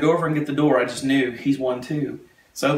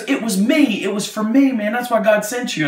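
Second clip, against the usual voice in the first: high, excited realisation; retold elation; 7 semitones higher, 4 dB louder than usual. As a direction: imitate him exclaiming with raised pitch. Man realising it was for him.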